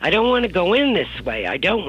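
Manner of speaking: high, fast stubborn refusal; arguing mother. Retold or imitated. Mother refusing to go in this way, fast stubborn protest. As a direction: dig in and refuse. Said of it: "she's arguing with me".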